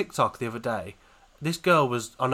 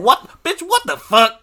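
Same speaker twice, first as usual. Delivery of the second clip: high, loud disbelieving shout; loud outburst; 10 semitones higher, 11 dB louder, slower than usual. Real, not acted. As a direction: blurt it out in outrage. Shocked outburst at the study.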